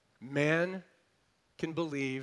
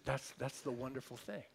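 normal talking voice

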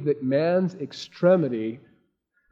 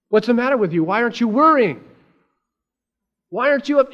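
higher, exasperated acted outburst; upset, freaking out; 6 semitones higher, 5 dB louder, faster than usual. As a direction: accuse them in mock panic. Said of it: "all freaking out over the problems".

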